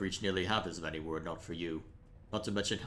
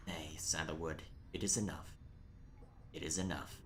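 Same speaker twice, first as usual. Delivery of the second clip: whisper; 3 semitones lower, 7 dB quieter than usual. Acted. Bertrude whispers in reply.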